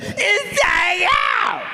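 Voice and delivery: very high, drawn-out mock shriek; meth-head woman. Woman insists to the baffled actor, slow high shriek. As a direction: stretch it out in a screeching falsetto. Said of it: "She said".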